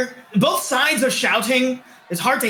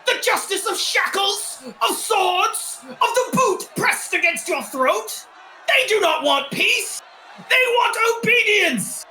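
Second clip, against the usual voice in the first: higher fiery agitator voice; rabble-rouser; 9 semitones higher than usual. Acted. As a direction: whip the crowd up against the crown. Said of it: "And then another one goes".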